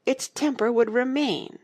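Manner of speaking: high, gloating finish; queen clinching the argument, triumphant. A narrator's reading. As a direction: crow over the win. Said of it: "exclaimed triumphantly".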